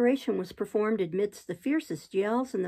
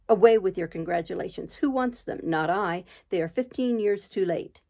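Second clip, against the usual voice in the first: usual speaking voice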